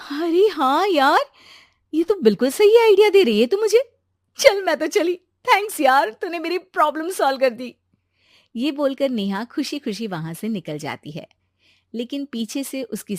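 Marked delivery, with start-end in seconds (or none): 0.1-7.8 excited. Acted. Girl thrilled by friend's idea.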